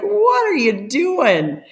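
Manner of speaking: a high, slow "what are you doing"; high incredulous husband. Retold or imitated. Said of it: "And he's like".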